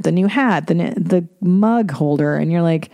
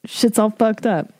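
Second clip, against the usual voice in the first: ordinary delivery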